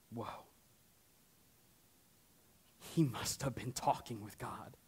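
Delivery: low, very soft voiced reaction of onlookers; murmured, awestruck quote; hushed awe. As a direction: play the crowd's quiet amazement, low. Voicing the awed nation's reaction.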